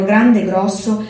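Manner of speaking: usual speaking voice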